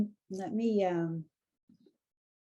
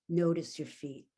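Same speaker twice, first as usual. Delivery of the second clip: guided meditation; 4 dB louder than usual. Acted. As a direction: lead them into stillness, unhurried. Leads a guided awareness exercise.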